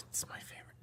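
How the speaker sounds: hushed whisper. Real, not acted. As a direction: whisper it like a secret. Secret aside.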